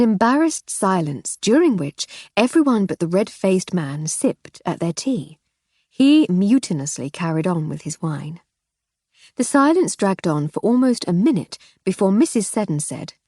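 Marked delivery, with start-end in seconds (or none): none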